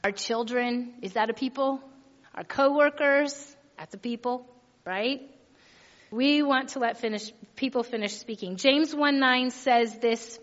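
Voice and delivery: usual speaking voice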